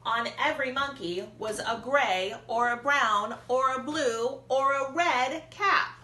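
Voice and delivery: plain reading voice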